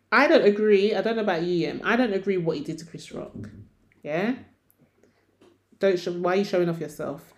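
usual speaking voice

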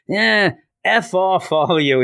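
mock mad. Mad man telling off the cheering crowd, mock angry. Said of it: "He's all mad".

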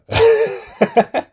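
a loud, high burst of real laughter. Real, not acted. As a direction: crack up loudly at the memory.

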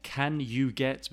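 "can you get" with full "you" and final T; separate, clear "can you get"; careful full form. Shown as a demonstration. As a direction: sound every word of "can you get". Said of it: "becomes can you get".

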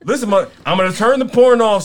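scolding. Real, not acted. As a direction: call him out sharply. Scolding Steve to focus.

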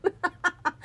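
high, laughing "which one"; laughing mimic. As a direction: squeak it out through laughter. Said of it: "I was like".

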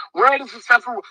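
ordinary talk

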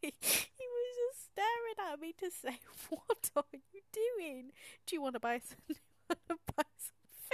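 can't stop laughing while telling it; giggling through the fish counter story. Real, not acted. Laughing retelling fish counter bloke.

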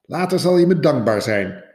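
snapped. Acted. Father rabbit snapping at his daughter, loud and sharp. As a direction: bite the words off at her. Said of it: "snauwde hij zijn dochter toe".

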